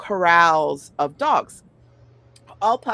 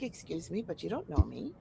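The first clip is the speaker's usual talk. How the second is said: much softer, icy put-down; cold quiet retort; 2 semitones lower, 12 dB quieter than usual. Retold or imitated. Giving a guy the stink eye, quiet icy retort. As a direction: hush it like a pointed brush-off. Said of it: "I'm like".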